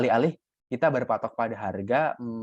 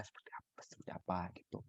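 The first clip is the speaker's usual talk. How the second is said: whisper; 5 semitones lower, 19 dB quieter, faster than usual. Real, not acted. Telling students to prepare answers, whispered. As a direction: drop into a breathy whisper.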